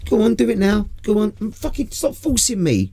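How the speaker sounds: high-pitched bickering outburst; mock protest. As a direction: play a heated squabble, high and urgent. Acted row over being forced.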